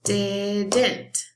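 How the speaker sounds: "Didn't" drawn out as di-dn't; two separate beats on "Didn't"; broken into syllables. Shown as a demonstration. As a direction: stretch "Didn't" so both syllables are heard. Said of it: "Let's listen".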